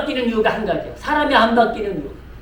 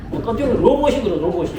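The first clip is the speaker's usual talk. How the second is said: ordinary talk, nothing marked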